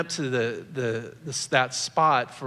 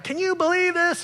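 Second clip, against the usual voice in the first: much higher, indignant cry; indignant outburst. Retold or imitated. Husband venting to his wife about the mean email, mock indignant. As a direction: throw it at your wife in mock outrage. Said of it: "so angry that I had to engage my wife on it and started like".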